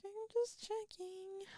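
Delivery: tiny high voice, very soft; mock-innocent squeak; meek excuse. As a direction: draw it out slowly and sheepishly. Acts out her meek excuse.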